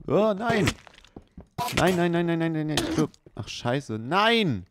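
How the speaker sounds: high, loud cries of no; real panic as the monster strikes; yelling no over and over in fright; panicked screams. Real, not acted. Attacked by a monster in game.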